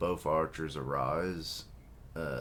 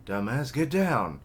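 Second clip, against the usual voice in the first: scolding order; 7 semitones higher, 6 dB louder than usual. Acted. Brother orders Tan down.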